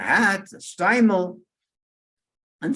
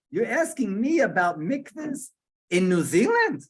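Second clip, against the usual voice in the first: incredulous indignation; 7 semitones higher than usual. Acted. Man's indignant reaction to question.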